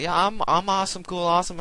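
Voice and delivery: squeaky self-satisfied brag; mock viewer boast. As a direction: viewers bragging back, in a high mock voice. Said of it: "you guys are gonna be like".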